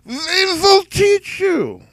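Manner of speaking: shrieks it high and loud; sudden shriek. Acted. Character shrieking the leather creed at his audience. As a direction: leap into a shrill shout on we will teach you. Leather pitch rant peaks.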